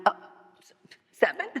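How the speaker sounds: high, quiet stammer through mock sobs; mock sobbing. Newcomer hears the early meeting time, mock tearful squeak. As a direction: squeak it out as if choked by tears, then laugh. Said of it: "Suddenly, I, like, cleared up".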